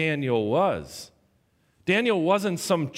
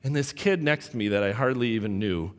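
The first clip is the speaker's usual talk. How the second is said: ordinary delivery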